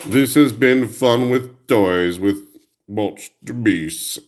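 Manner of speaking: slow show sign-off announcement; outro. Acted. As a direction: sign off the episode like a host. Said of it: "show sign-off".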